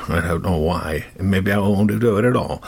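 usual speaking voice

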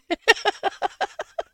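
high laughter trailing on; real high giggling fit; helpless laughing fit. Real, not acted. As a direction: laugh till you could cry. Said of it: "laughed so hard they cried".

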